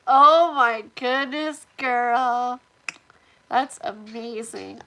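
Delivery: loud, slow, delighted gasp; excited exclamation. Real, not acted. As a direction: burst out with delight. Delighted reaction to chat comment.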